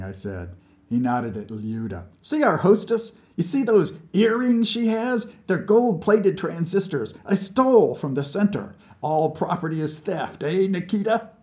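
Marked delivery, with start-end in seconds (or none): 2.2-11.3 much higher, louder proud rant; tipsy scientist boasting to a new pal; drunken boast. A narrator's reading. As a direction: crow about beating the Americans. Vlad boasts of theft.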